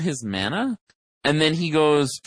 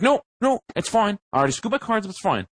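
sulky friend; 7 semitones higher, faster than usual. Retold or imitated. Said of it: "He's like".

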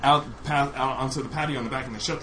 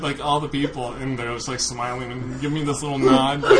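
normal talking voice

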